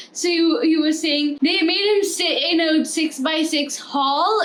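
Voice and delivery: usual speaking voice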